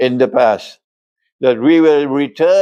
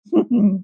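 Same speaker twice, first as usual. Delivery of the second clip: a short high giggle slipping out; laughing in spite of himself; real laugh; 6 semitones higher than usual. Real, not acted. Lecturer breaking off mid-lecture in a high giggle. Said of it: "I'm laughing".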